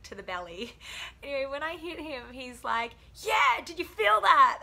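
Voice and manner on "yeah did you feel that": much higher, much louder laughing yell; hammed-up officer laughing. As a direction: crow with laughter, slowly. Officer voiced after being punched.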